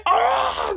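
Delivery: high hammed-up scream; sudden comic yelp of death; mock death cry. Co-player cuts guard's song short, mock death cry.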